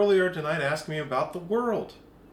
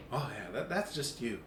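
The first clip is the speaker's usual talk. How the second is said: much softer, lower approval; 6 semitones lower, 10 dB quieter than usual. Retold or imitated. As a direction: quietly approve the shoes. Friend approves Vans.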